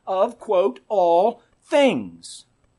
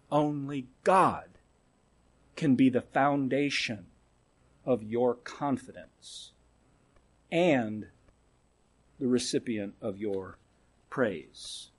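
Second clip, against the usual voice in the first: hushed reverent drop; 9 semitones lower, 9 dB quieter than usual. Real, not acted. Preacher lowering his voice over God alone. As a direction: let it fall to a near hush.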